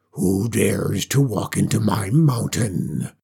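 low, slow, booming dragon. Acted. Dragon challenging the girl at its cave, low and slow. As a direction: growl it out like a huge beast.

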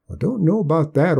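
put-on a stubborn backwoods old-timer; old country man. Old Hark voiced by narrator.

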